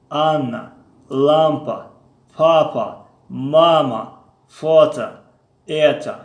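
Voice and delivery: each word said loud and stretched with a falling tune; teacher reads word list for class to repeat, loud and deliberate; slow model reading. Shown as a demonstration. Teacher models Russian words for students, slow and loud.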